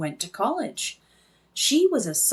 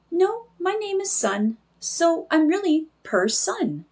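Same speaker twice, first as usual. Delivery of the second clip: tiny voice; 8 semitones higher than usual. Acted. Tiny figure names itself.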